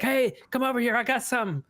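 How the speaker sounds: high, eager call across the room; excited calling out. Retold or imitated. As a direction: call them over, thrilled. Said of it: "somebody would be like".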